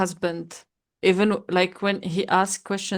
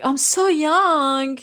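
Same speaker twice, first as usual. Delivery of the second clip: mock indignant protest; 8 semitones higher, 5 dB louder, much slower than usual. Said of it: "and I was like".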